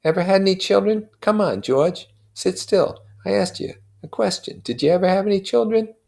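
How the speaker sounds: high, pleading friend's voice pitched well up; Michaelis's voice. A narrator's reading. Neighbor pressing grieving Wilson for an answer, high and urgent.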